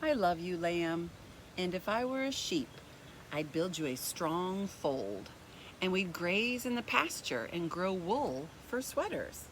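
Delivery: gentle, even storybook reading; reading to children. A narrator's reading. Teacher reading a picture book to kids, gentle storybook voice.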